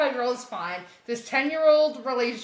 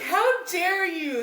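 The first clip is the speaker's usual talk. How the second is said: mock outrage; 6 semitones higher, much slower than usual. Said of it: "And he's just like".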